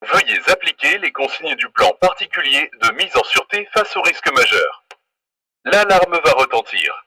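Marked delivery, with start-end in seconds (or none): none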